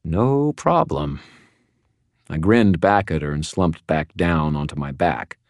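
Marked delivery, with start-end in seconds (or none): 0.0-1.2 high, drawn-out, teeth-clenched reply; through clenched teeth. A narrator's reading. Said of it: "said through clenched teeth".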